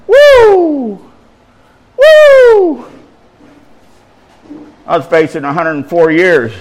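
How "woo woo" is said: high, loud "woo"; real whoop shooting up high; excited whoop. Real, not acted. Preacher celebrating just have faith, whooping.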